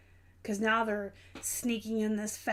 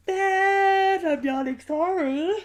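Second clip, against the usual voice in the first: loud, drawn-out emphasis on bad; emphatic; 8 semitones higher, 10 dB louder, much slower than usual. Real, not acted. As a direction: belt it out defiantly. Emphatic complaint about bad storages.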